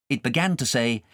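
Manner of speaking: ordinary delivery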